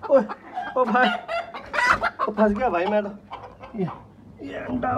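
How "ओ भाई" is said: ordinary delivery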